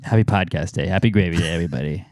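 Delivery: deflated, flat-out joyless greeting; dragging low sarcastic cheer; unenthusiastic. Real, not acted. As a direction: say it like you've given up. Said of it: "Way to have some enthusiasm behind that one".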